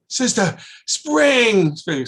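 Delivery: high, slow, drawn-out spring; high stretched-out child's cry; excited child. Retold or imitated. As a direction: announce it with wide-eyed wonder. Child tells the nun.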